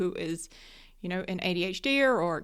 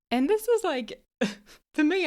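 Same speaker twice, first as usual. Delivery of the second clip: laughing, pitched up high and louder; 7 semitones higher, 5 dB louder than usual. Real, not acted. To listeners about her partner, laughing exasperation. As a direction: laugh through it, still amazed at him.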